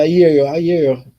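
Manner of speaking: ordinary talk, nothing marked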